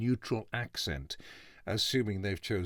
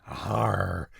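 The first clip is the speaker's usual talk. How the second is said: a West Country pirate growl on arr; a lightly done Bristol pirate arr; 3 semitones lower, 3 dB louder than usual. Retold or imitated. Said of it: "nothing which would make anyone say".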